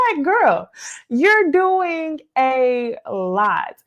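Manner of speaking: slow, side-eyed scolding. Real, not acted. Commenting on cast member.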